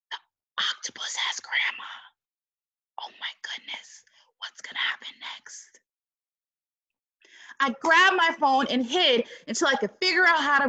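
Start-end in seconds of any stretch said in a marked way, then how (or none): 0.1-5.8 hushed spooky whisper; suspense whisper. Acted. Reader hushes the kids at the scary part, whispered.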